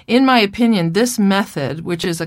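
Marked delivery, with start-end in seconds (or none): none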